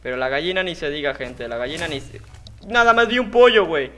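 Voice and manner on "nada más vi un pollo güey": higher and louder, outraged; indignant yell. Real, not acted. Gamer hit in the face by a chicken, indignant yell. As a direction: shout it in disbelief.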